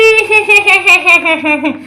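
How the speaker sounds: long falling "hehehe" cackle; witch cackle. Pretend. Witch gloats after refusing, long mocking cackle.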